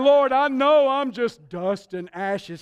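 loud, slow mock humility; amused, hammed-up plea with laughter; laying it on thick. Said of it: "He was laying it on thick".

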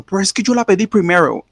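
high, loud indignant complaint; imitating the man insisting he asked first; whiny protest. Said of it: "le dijo".

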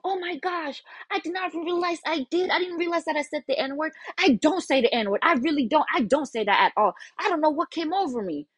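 put-on high, breathless girly protest; flustered denial. Jenny insisting to her coworker, high flustered denial. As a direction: play the guilty coworker swearing innocence. Jenny denying she says it.

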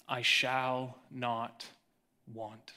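very slow, each word held, fading on want; slow, savoured verse. Shown as a demonstration. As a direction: linger on every word and let it die away. Verse savoured slowly, trailing off.

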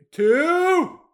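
loud, high bellow of the count; shouted count. Acted. Troll bellowing two at hiding goblins. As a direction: roar the number out as a threat.

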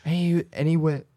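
ordinary delivery

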